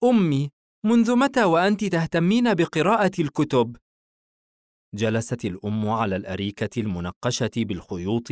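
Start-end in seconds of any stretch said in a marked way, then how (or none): 0.0-3.8 put-on high-pitched boy questioning his mother; young son. Son questioning his mother after father leaves, high boy voice. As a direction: quiz her as the son, pitch well up. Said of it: "بورسن بعد مغادرة أبيه".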